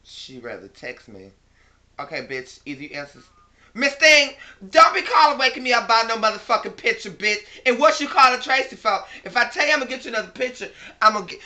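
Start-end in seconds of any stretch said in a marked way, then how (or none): 3.7-11.4 loud, high, furious tirade at a caller; angry phone yelling. Real, not acted. Woken by a friend's call, yelling angrily down the phone. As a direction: chew her out down the phone, much higher and louder.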